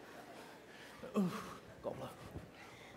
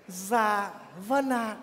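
sweet talk; 3 semitones lower, 8 dB quieter, much slower than usual. Acted. Mocking sweet northern girl.

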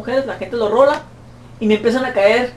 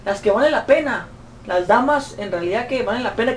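ordinary delivery

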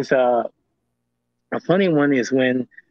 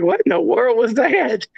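high-pitched, incredulous questioning; baffled disbelief; 7 semitones higher than usual. Retold or imitated. Reacting to being called the Sasquatch King, high baffled disbelief. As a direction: retell your own astonishment, pitched up high.

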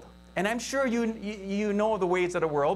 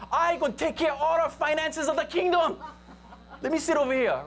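higher, laughing bid for the seat; a hint of a boastful Matthew, pleading with a laugh; Matthew's voice; 6 semitones higher than usual. Matthew pitching himself to Jesus for a seat, laughing. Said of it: "And Matthew is speaking like this".

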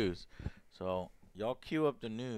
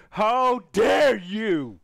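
shouting; 8 semitones higher, 10 dB louder, much slower than usual. Pretend. Repeated outburst, first take.

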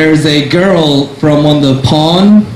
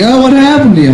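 put-on higher, sympathetic older lady voice; a lady asking gently at the bar, higher pitch; 9 semitones higher, faster than usual. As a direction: coo like a kind stranger at a bar.

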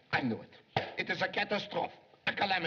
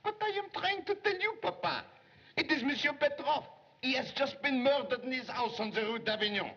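high, pitched-up and pressing; urgent report; 9 semitones higher than usual. Acted. Secretary pressing his godfather about the murder, urgent. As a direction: blurt out the news so he'll listen.

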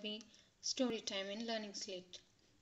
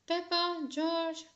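loud, slow call, pitched up; 7 semitones higher, 5 dB louder, slower than usual. A narrator's reading. Father calling the children in from the mud, loud and slow. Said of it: "called Daddy Pig".

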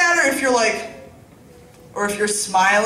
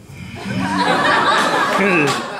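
breathy laugh; 5 semitones lower than usual. Real, not acted. Laugh before punchline.

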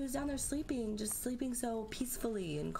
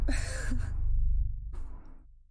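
nervous laugh; 9 dB louder than usual. Real, not acted. Nervous laugh at scary moment.

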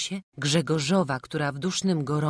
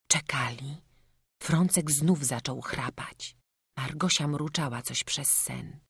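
usual speaking voice

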